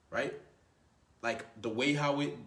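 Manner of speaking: ordinary talk, nothing marked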